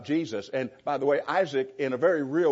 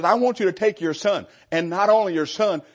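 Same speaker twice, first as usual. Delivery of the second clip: raised, pressed command, "son" stressed; emphatic quote; 5 semitones higher, 7 dB louder than usual. Shown as a demonstration. Preacher stressing God's words to Abraham, emphatic. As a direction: push the words out firmly. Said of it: "But he says".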